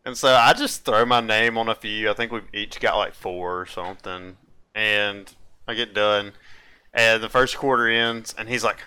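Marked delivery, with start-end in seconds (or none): none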